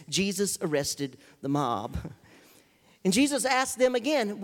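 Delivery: usual speaking voice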